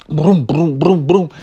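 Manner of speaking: repeated rolling brooms; rumbling noises. Retold or imitated. Storyteller imitates the truck rolling downhill, rumbling noise.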